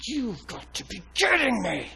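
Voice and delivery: high, strained mock outburst; exasperated inner outburst. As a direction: protest in retold disbelief. His frustrated inner outburst, retold.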